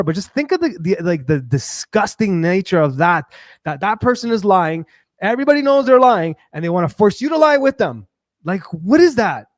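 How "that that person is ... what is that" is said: real rising exasperation, stressing words hard; worked up. Real, not acted. Streamer fed up with forced lies, worked up. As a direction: get heated and push each point. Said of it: "Sorry, I'll calm down".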